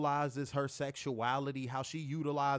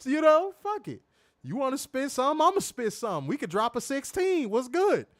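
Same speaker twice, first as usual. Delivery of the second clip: hyped up greeting; 10 semitones higher, 6 dB louder than usual. Retold or imitated. Hyping himself up to Trish.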